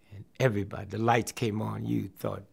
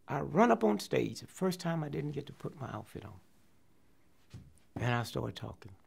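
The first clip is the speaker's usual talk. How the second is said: normal talking voice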